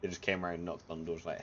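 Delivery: ordinary talk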